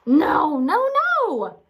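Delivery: very high, loud annoyed shout. A narrator's reading. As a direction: stop him in exasperation. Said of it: "Boris shouted".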